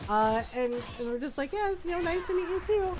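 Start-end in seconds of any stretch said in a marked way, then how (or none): 1.5-3.0 a startled, higher polite reply; flustered politeness. Retold or imitated. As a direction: caught off guard, answer sweetly anyway. Stunned polite reply to mom.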